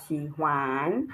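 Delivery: "xi huan" with huan dipping then rising; wrong third tone. Shown as a demonstration. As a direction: slowly lift the end of huan. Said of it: "there is no tone".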